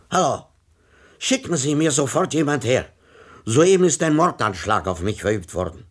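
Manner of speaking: loud, high and urgent into the telephone; a shaken old man demanding help right now; agitated phone call. A narrator's reading. Tomsa reports attack by phone. (aufgeregter, lauter Anruf)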